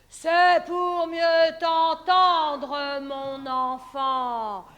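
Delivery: very slow, loud growl; wolf voice. Acted. Wolf answering the girl. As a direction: growl it out slowly.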